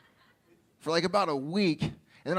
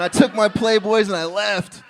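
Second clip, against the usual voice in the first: loud huffy exit; 4 semitones higher, 9 dB louder than usual. Acted. Indignant punchline exit.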